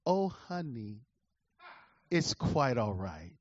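a lightly voiced, syrupy wife, slow and low; syrupy sweet wife, slowed down; mock sweet wife. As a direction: purr it like a too-sweet wife. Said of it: "she immediately comes back and says".

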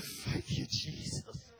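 breathy whisper of thanks; whispered prayer. Retold or imitated. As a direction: whisper it to God under your breath.

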